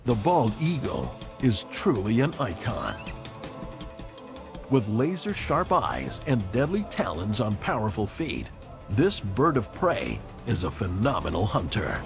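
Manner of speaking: ordinary talk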